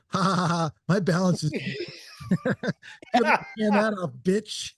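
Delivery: hammed-up cackling gloat; mock gloating. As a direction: gloat at them from beyond the grave, laughing. Gloating dying person's joke note.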